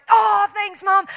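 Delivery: loud delighted shout to mum; excited thanks. Retold or imitated. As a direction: yell a thrilled thank you.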